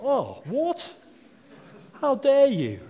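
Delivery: high, loud hammed-up dad indignation; mock outrage. Dad to the kid who wants mayonnaise, high mock outrage. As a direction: play the offended cook dad, over the top. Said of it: "You're like".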